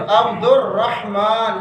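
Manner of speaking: عبد الرحمن slowly, "abdor-RAH-maan"; correct pronunciation. Shown as a demonstration. Teacher modelling the name for class, correct pronunciation. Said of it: "غلط ہے".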